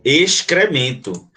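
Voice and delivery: "Excremento" said slowly with the X and the C both sounded; "Excremento" drawn out, ex-cre-men-to; slow syllable demo. Shown as a demonstration. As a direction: stretch out "Excremento" for the class. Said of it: "você pronuncia o X e pronuncia o C".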